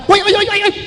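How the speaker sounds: high, sharp mock cry of alarm; a mother lunging to stop her child. Mother stopping her child from giving, high alarmed call.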